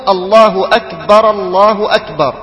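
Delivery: أكبر said akbara, the ra with an open a, linked to the next الله; first أكبر joined as akbara llah; fatha on the ra. Retold or imitated. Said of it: "يفتح الراء فيقول".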